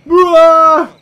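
loud, high burst of noise; explosive bwah. Real, not acted. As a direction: blast out a silly roar. Rampage begins, sound burst.

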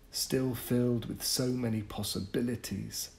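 plain reading voice

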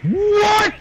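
sudden high shocked yell. Real, not acted. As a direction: yelp it in disbelief.

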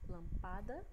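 lampada stressed on PA; wrongly stressed lampada, lam-PA-da; stress on middle syllable. Shown as a demonstration. Teacher repeating a wrong option, lampada stressed on PA. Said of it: "Vocês observaram a diferença?".

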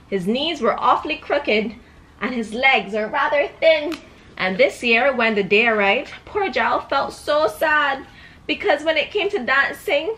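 put-on storybook voice for a little girl; reading to a child. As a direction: read it like a bedtime book. Reads picture book to child.

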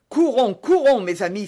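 loud, high rallying cry; urgent cry. A narrator's reading. D'Artagnan fears a murder and rallies his friends, crying out. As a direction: call your friends to run, urgently. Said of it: "s'écria D'Artagnan".

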